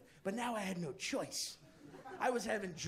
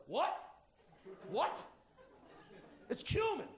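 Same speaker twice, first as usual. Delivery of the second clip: mock outraged roommate; 5 semitones higher than usual. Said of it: "And he goes".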